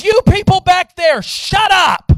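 loud, high shout; angry leader yelling. Retold or imitated. Leader to the people at the back, angry shout. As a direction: yell across the room to silence them. Leader yells at group in story.

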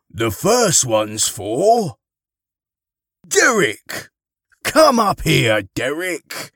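loud, slow, hearty summons; Father Christmas calling. Acted. Santa calls Derek up for a gift, hearty call. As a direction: call the name out across the room.

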